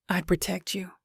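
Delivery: barely voiced vow; whisper. A narrator's reading. Dying man whispers his promise to her. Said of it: "he whispers".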